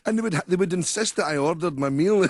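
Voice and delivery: normal talking voice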